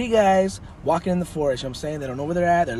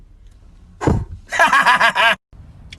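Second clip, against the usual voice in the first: loud, high laughing fit; real belly laugh; 8 semitones higher, 7 dB louder than usual. Real, not acted. Comic laughs at his leprechaun joke, loud laugh. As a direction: crack up after the punchline.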